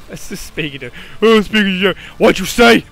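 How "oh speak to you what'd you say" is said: loud, higher mock retort; mock indignant. Real, not acted. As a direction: snap back at the monster, higher and louder. Mock reply to game character.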